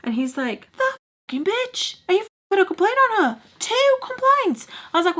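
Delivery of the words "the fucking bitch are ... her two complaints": much higher, indignant voice; outraged Charlie. Retold or imitated. Charlie hearing about the rude woman, high-pitched outrage. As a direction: squeak it up in mock outrage.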